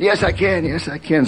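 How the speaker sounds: high, eager retort; a retold eager yes, pitched high; eager insistence. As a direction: brush off the doubt with zeal. Insisting he can still hike.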